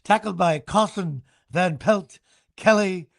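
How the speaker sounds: imitating PA announcer. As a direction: roll out each name like the announcer. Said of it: "It was, like".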